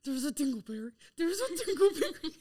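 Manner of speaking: put-on childish giggle through the words; giggling like a schoolkid. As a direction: laugh it out like a naughty kid. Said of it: "laughing like a school child".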